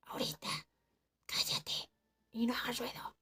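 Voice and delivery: stern hushed whisper. Retold or imitated. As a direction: hush her without making a sound. Hushing her in hiding.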